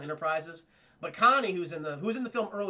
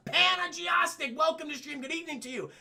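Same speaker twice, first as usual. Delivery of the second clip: excited greeting; 12 semitones higher, 4 dB louder than usual. Real, not acted. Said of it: "greeting chat viewer".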